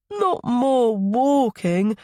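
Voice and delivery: put-on high, drawn-out child's whine; slumping small boy. A narrator's reading. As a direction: drag it out like a reluctant little boy. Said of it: "Milo slumps".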